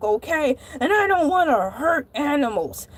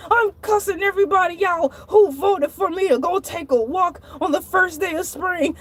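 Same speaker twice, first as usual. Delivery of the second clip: ordinary delivery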